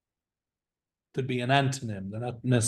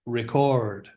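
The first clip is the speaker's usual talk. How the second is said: record stressed on the second syllable; record with a long, strong CORD; verb stress. Shown as a demonstration. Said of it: "And the verbs".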